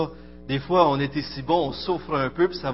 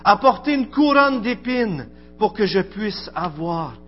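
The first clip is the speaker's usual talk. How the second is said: lecture recueillie; 3 semitones higher than usual. Acted. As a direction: read it like a litany over music, rising then fading. Recites a devotional text over music. (lecture solennelle et recueillie sur fond de musique)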